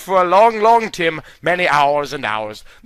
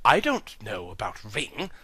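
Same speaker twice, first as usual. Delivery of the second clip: low, soft hobbit voice; 8 semitones lower, 11 dB quieter than usual. Acted. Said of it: "said Bilbo".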